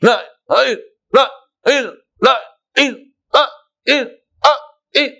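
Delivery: 'la, ha, il' split apart, high and loud; each syllable cut off as the error; chopped syllables. Shown as a demonstration. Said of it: "dia bunyi lam alif hamzah". (suku kata 'la, ha, il' yang terpotong)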